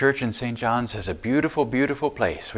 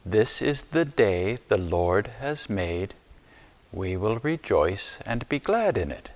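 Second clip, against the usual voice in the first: slow recitation. Shown as a demonstration. Teacher says the words slowly with the children, slow and clear. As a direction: say each word slowly and clearly. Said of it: "Can you say that with me?".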